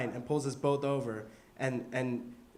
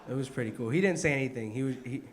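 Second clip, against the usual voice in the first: ordinary delivery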